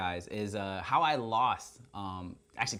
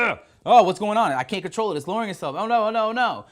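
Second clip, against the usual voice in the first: much higher and louder, frantic retold panic; imitating panic; 9 semitones higher, 12 dB louder than usual. Cameraman Tony panicking as the drone sinks, loud mock panic. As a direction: replay the cameraman losing control, hammed-up alarm. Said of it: "Tony starts freaking out".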